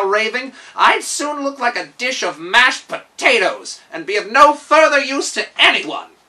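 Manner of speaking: fretful gnome steward, loud and high; nervous servant warning loudly of his master's rage; Kaliko the steward. Acted. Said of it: "remarked Kaliko".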